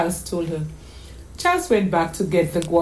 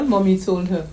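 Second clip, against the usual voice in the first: ordinary delivery, nothing marked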